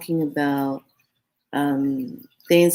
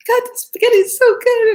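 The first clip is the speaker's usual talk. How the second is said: much higher and louder rapture; ecstatic squeal; 8 dB louder than usual. Retold or imitated. Recalling her first bites of the spaghetti, ecstatic high squeal. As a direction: gush like it's the best pasta ever. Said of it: "and I'm like".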